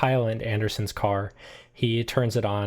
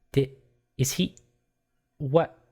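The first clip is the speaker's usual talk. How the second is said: halting fragments, rising in pitch; stunned confusion; 7 semitones higher, 3 dB louder than usual. Acted. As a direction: stammer in disbelief between long pauses. Lily is stunned by the death.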